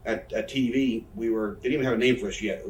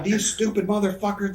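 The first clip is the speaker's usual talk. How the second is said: high-pitched imitation of a yell in a Southern accent; mock Southern yell; 10 semitones higher than usual. Wrestler retelling his rant about the match, mock Southern yell. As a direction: retell an angry outburst, slipping into Southern. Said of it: "when I yell like him, I all of a sudden become, I get a Southern accent".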